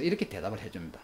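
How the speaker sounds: ordinary delivery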